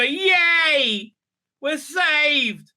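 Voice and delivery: high, drawn-out mock cheer. Presenter cheering hopeful show news, mock-jubilant. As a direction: cheer as if rescued.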